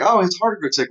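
high-pitched, sympathetic "oh"; mock sympathy. Teacher voices a reader feeling for Montresor, mock sympathetic. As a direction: coo with put-on pity.

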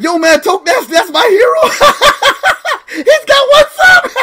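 high mock fanboy quote cracking up; laughing self-quote. Streamer mocking his own fanboy moment, laughing. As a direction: act out your excitement, then lose it laughing. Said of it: "I was like".

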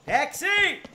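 calling a taxi. Real, not acted. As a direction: hail a cab across the street. Calling out for a cab.

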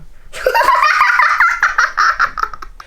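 high unvoiced laughter. Real, not acted. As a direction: burst out laughing, breathy and high. Laughs at camera mishap. (높고 숨 섞인 웃음)

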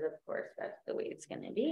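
muttering under her breath, resigned; whispered aside. Real, not acted. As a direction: whisper it to yourself. Tech failing, muttered aside.

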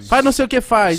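high, loud coaxing mom; mocking proud mom. Proud mom pushing her baby to perform, high and loud. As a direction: egg the baby on, showing off. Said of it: "A mãe dele falava".

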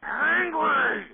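high, loud growing yell on one word; angry. Real, not acted. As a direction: burst into an angry drawn-out cry. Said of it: "it just gets me angry".

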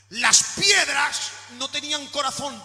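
normal talking voice

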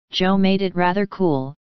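plain reading voice

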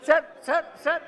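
loud calls. Real, not acted. Member interrupting to get the floor, shouting sir. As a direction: shout to grab the Speaker's attention. Member pressing Speaker for turn.

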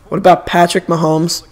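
normal talking voice

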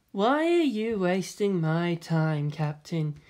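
slow, cool, lightly voiced impatient woman; flat Ritsuko. A narrator's reading. Ritsuko confronting Misato at the elevator, flat and slow. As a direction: say it flatly and slowly. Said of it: "she said flatly".

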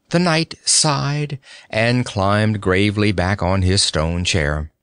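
usual speaking voice

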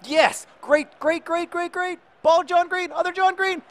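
very high, loud, breathless-with-hope commentary; excited play-calling. Real, not acted. Gamer following a promising attack, excited high yelling. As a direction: call it high and urgent as the attack builds. Attack building toward goal.

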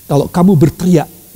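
usual speaking voice

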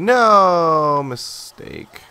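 drawn-out slow cry. Real, not acted. Relieved after close call.

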